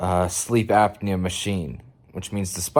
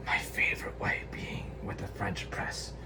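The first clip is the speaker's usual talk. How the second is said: whispered aside; 3 semitones lower, 13 dB quieter than usual. Real, not acted. Vlogger whispering a side note about coffee.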